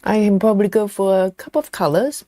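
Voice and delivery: talking round a mouthful of chocolate; eating chocolate. Real, not acted. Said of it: "Sorry, I'm talking with chocolate in my mouth".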